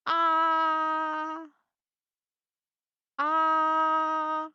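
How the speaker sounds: "ah" held long on one high, level pitch; high flat tone. Shown as a demonstration. Teacher demonstrating the first tone, long high flat "ah". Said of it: "a high and flat tone".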